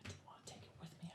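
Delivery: quick, barely audible whisper; defensive whisper. Acted. Excusing the missing item while hiding, defensive whisper.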